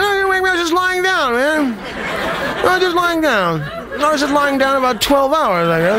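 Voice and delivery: high, strained squeaky protest; fake-awake denial. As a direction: insist you were only lying down. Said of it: "you deny it".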